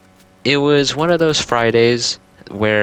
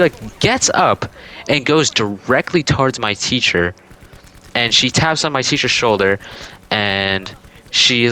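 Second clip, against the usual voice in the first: plain reading voice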